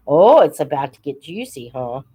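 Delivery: normal talking voice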